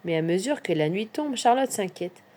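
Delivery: ordinary delivery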